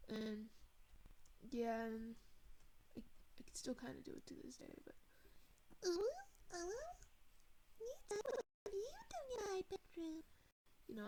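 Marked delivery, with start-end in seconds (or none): 5.8-10.2 much higher cutesy uwu anime voice. Shown as a demonstration. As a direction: put on a squeaky cute anime girl act. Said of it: "be able to do a kinda uwu voice".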